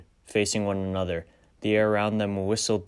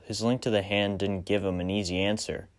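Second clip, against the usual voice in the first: plain reading voice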